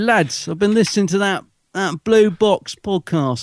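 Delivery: Cockney hard man egging on his crew, drawn out; mock Cockney gangster. Telling his lads about the podcast, mock Cockney gangster.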